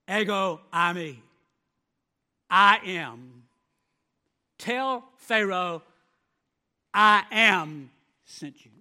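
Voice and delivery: loud, slow and solemn, each word landing; booming voice of God. Acted. God answering Moses in a booming solemn voice. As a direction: proclaim it as God thundering from above.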